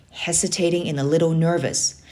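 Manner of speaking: usual speaking voice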